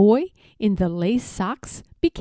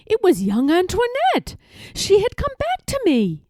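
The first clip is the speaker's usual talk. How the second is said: higher, delighted surprise; excited, high; 9 semitones higher than usual. A narrator's reading. Squirrel narrator to listeners, suddenly excited and high. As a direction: lift it high with wonder. Narrator sees Antoinette again.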